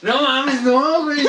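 laughing high in disbelief; cracking up mid-sentence. Real, not acted. Laughing at friend's face.

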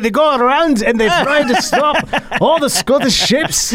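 mock Scottish sea monster accent. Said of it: "I didn't want to go with my pirate voice".

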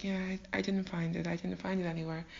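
soft, stammered embarrassed admission; meek mumble. Acted. Laban repeating his failure to Jacob, meek mumble. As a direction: mumble it smaller and smaller. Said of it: "he probably mumbled to Jacob".

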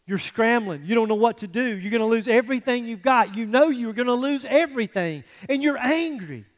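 escalating pulpit cadence; rising preaching. Acted. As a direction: pile it up like a preacher gathering steam. Builds intensity on job loss.

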